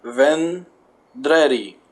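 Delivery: vendredi said slowly in French; vendredi, drawn out the French way; French word. Shown as a demonstration. Reading the French word vendredi slowly off the card. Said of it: "I'm assuming they roll their R's in France".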